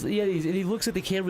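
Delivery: normal talking voice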